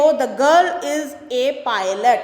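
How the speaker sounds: very slow, each word read out for the class; slow classroom dictation. Shown as a demonstration. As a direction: read it slowly, like dictating to students. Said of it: "fifth देखो".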